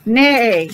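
whinny like a horse; playful horse whinny for children; horse neigh. Shown as a demonstration. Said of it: "I'm not very good with animal songs".

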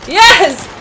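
high, loud burst of victory; triumphant shout. Real, not acted. Player winning a duel, triumphant shout. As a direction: punch it out in triumph. Won the fight.

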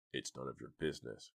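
low, soft, menacing man; gruff growl. A narrator's reading. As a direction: growl a quiet brush-off. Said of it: "growled the man".

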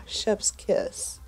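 ordinary talk

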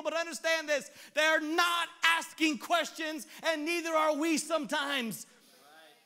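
loud, high, driving sermon; preaching at full force. Acted. Pastor to the congregation, preaching at full force. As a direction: preach it hard to the room.

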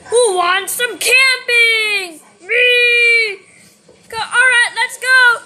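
high, loud, excited rallying cry; excited shout. Acted. Rallying friends for a camping trip, excited shout. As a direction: whoop it up loudly.

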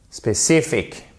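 SPÉCIFIQUE as English specific, stress on CI; stressed second syllable. Shown as a demonstration. Teacher gives the correct English word, stress on CI. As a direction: punch CI, relax the last vowel of SPÉCIFIQUE. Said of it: "mais bien spécifique".

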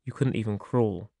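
soft, subdued repetition; understated sorrow. A narrator's reading. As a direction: repeat it softly, with only a touch of sobbing sorrow. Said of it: "I sobbed and kept repeating".

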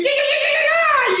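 very high squealing yeahs; high excited yeahs. Real, not acted. Fan squealing over beating the Saints. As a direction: shriek them with joy.

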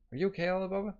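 high questioning voice; concerned character voice. Acted. Another character checks on him.

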